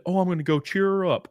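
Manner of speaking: lightly mock a well-meaning do-gooder; well-meaning fan. Voices hypothetical fan meaning well.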